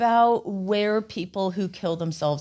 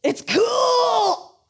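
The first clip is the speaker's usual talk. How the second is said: high shout; 9 semitones higher than usual. Acted. Shouted card line, band voice.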